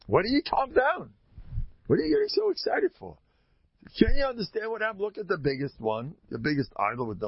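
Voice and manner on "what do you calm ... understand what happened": high and fast, waving it off; excited protest. Acted. Avram talking his father down, high and quick. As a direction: rush it out, high and eager.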